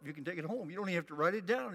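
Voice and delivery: ordinary delivery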